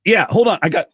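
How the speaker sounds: high, loud, scrambling and laughing; flustered excited. Retold or imitated. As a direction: fumble for the phone in a panic. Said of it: "I'm like".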